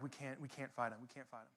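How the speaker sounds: low, very hushed imitation of fearful soldiers; afraid, cowering. Soldiers facing Goliath, too afraid to fight, hushed. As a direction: shrink down almost to nothing, voicing their defeat. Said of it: "They were so afraid of this Goliath guy".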